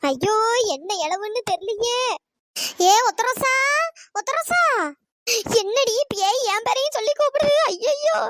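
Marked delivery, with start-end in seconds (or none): 2.9-4.6 a haunting call from somewhere unseen; eerie ghost call. Acted. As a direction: lure her with a ghostly voice. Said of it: "வேற குரல்ல கூப்பிடுது".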